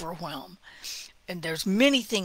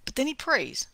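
ordinary talk, nothing marked

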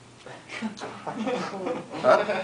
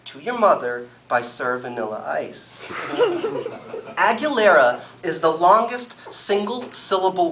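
a lively deadpan poem reading, pitched up; poetry performance; 7 semitones higher, 5 dB louder than usual. Acted. Poet reading a comic poem to a live audience, deadpan. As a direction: recite it like a straight-faced slam poet.

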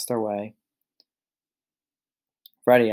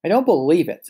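shocked; 6 semitones higher, 6 dB louder than usual. A narrator's reading. Pig shocked at locked door.

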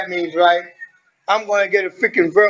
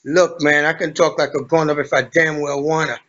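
lower, serious grown-up voice; 4 semitones lower than usual. Shown as a demonstration. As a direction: drop into a mock-mature adult tone. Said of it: "stop talking like this and talk like a normal grown-up".

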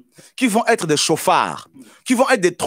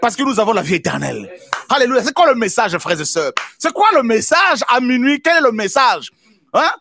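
full-force preaching; 6 semitones higher than usual. Acted. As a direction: preach it out, rousing the church.

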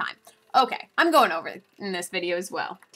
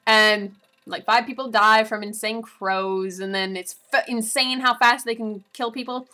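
ordinary talk, nothing marked

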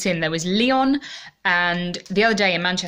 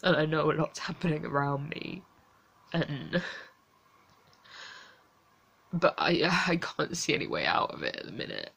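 quiet, tearful and worn out; exhausted, close to crying, barely above a murmur; near tears; 7 dB quieter than usual. Real, not acted.

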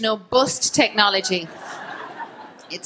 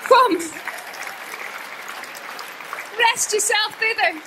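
slow, high, luring theatrical call; seductive stomach; 6 semitones higher, slower than usual. Pretend. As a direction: beckon like a tempting siren. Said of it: "your stomach's going".

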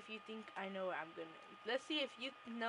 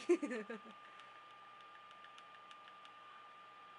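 high laugh; 4 dB quieter than usual. Real, not acted. Laughs at being sussed.

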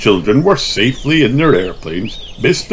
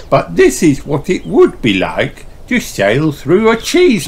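Miss Rabbit voice; 8 semitones higher than usual. Acted. Miss Rabbit welcomes the party, cheerful guide voice. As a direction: greet them like a bubbly guide.

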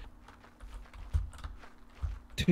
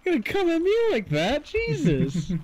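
mock indignant; 10 semitones higher than usual. Mock indignant comeback to teasing.

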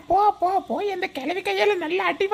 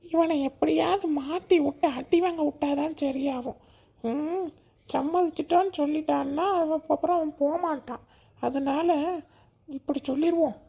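scheming aside; 6 semitones lower, 6 dB quieter than usual. Acted.

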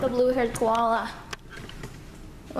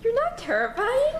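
high teasing voice; 12 semitones higher than usual. Pretend.

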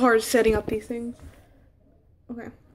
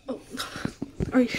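usual speaking voice

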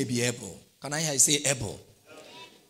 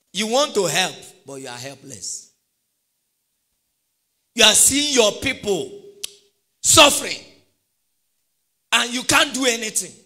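rising preaching; 7 semitones higher, 8 dB louder than usual. Acted.

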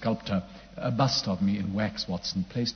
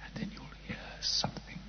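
whisper; 10 semitones lower, 11 dB quieter than usual. Acted. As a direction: breathe it, no voice at all. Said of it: "Quiet now".

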